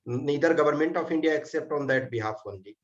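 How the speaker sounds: ordinary talk, nothing marked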